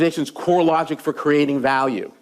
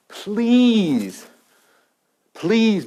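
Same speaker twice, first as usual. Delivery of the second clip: higher, drawn-out begging please; mock pleading; 6 semitones higher than usual. Inventors begging Kodak to help them, mock pleading. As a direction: plead like a desperate salesman.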